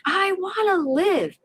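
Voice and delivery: heartfelt plea to survive; crying out. Retold or imitated. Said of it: "I cried out".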